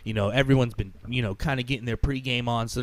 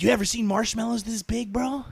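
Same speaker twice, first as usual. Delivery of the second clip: higher, amazed and eager; enthused passenger; 8 semitones higher than usual. Retold or imitated. Passenger showing off marshmallows to the driver, higher and amazed. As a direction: marvel at giant marshmallows. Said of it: "genuinely just enthused".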